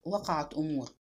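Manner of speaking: low, soft mutter; subdued murmur. A narrator's reading. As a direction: murmur it faintly with a lukewarm smile. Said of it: "فابتسم ابتسامة فاترة وتمتم".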